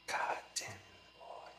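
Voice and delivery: breathy hushed praise; mock proud whisper. Father whispering pride about son's wrestling dog. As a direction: whisper it like an impressed dad.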